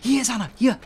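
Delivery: high, loud urgent callout; panicking gamer. Real, not acted. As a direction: yell the warning in alarm. Enemy spotted, alerting teammate.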